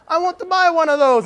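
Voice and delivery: loud, high, badly imitated Jamaican accent; Jamaican neighbor. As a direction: call it out in a hammed-up Jamaican accent.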